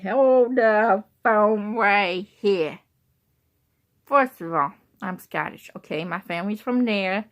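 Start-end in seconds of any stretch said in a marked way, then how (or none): 0.0-2.8 loud, drawn-out announcement; emphatic halt. Real, not acted. Vlogger stopping everything to explain her Scottish roots, loud and drawn out. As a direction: stretch every word out loudly. Halts herself to explain the name.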